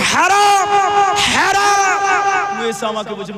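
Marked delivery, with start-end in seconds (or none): 0.1-2.0 very high, loud, stretched shout; clerics' condemning cry. Retold or imitated. Clerics declaring smoking forbidden, loud condemning shout. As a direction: cry it out as a thundering ruling. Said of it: "আমরা মৌলভীরা বলছি".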